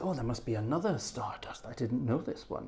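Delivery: hushed voice of a baffled listener; puzzled inner thought. Retold or imitated. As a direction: think aloud softly, as the puzzled requester. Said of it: "he thinks".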